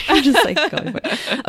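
laughing through the words. Real, not acted. Laughing at friend's energy.

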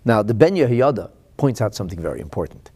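usual speaking voice

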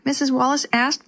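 ordinary delivery

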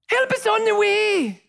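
long, high falsetto nanny call; Missus Doubtfire. Acted. Preacher reminding the crowd of the scene, high drawn-out nanny cry. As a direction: imitate a hooting nanny coming to the rescue. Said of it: "Missus Doubtfire shouts".

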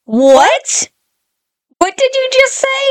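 high, stunned what did you just say; reacting to a child saying something horrifying; shocked disbelief. Parent startled by kid, shocked high voice.

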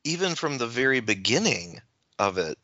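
usual speaking voice

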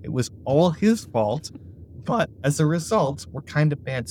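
ordinary delivery

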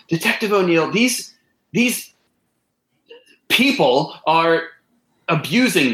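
high, strained pleading cry; panicked victim. Acted. Suspect begging Detective O'Neill for help, high and panicked.

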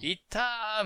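lightly mimicked fight commentary; boxing commentator. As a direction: call the attack like a ringside commentator, drawn out. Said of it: "ボクサーとかの".

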